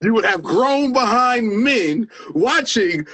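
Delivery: high-pitched, fervent testimony; impassioned. Real, not acted. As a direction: testify with rising fervor, stretching the key words. Impassioned recall of a tearful performance.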